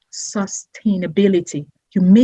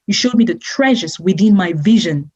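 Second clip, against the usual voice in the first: normal talking voice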